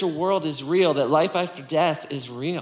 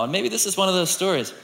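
usual speaking voice

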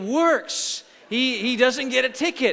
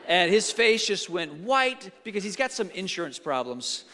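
normal talking voice

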